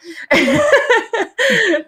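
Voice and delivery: bright high burst of laughter, falling away. Real, not acted. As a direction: let a high laugh spill out and fall. Laughs at herself.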